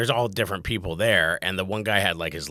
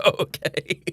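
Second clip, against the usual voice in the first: startled okay; 8 semitones higher, 7 dB louder than usual. Retold or imitated. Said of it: "And I'm like".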